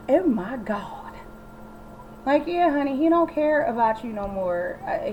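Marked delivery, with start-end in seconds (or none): 0.1-1.1 slow, breathy whispered oh my God; shocked whisper. Retold or imitated. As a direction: whisper it in mock horror. Suki shocked by the news.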